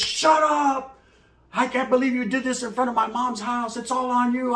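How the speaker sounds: high, angry scolding voice; angry girlfriend. Retold or imitated. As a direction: scold him hard. Baby's mom yelling at him.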